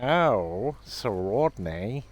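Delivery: slow, swooping posh impression; rough stab at a posh actor's drawl; Kenneth Williams. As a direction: draw out each word in a posh comic voice. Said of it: "It's something like that".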